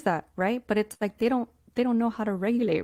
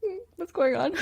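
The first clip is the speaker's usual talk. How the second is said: pitched way up, quizzical hum; a light, high, puzzled question; mock-concerned high voice; curious mock concern; 11 semitones higher than usual. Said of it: "I'd be like".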